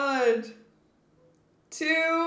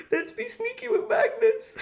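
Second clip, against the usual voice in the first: higher, laughing voice; giggly scheming; 7 semitones higher than usual. Real, not acted. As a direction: giggle through the sneaky plan. Repeats plan, giggling.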